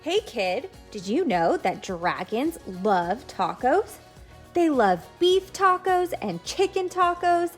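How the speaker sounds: ordinary delivery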